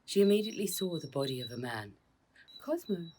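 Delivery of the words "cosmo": high anguished cry of a name; cried out. A narrator's reading. Josephine finding Cosmo hurt, crying his name. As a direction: call his name in distress. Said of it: "cried Josephine".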